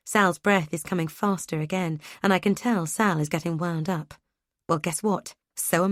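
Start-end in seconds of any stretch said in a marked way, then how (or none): none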